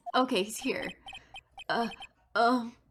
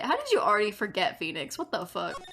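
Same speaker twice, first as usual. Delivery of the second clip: usual speaking voice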